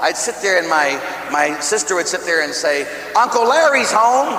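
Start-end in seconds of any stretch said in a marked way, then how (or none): 3.2-4.4 high, louder happy call; cheerful announcement. Retold or imitated. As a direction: call out gladly that he's home. Sister announces arrival.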